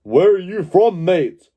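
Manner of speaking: ordinary talk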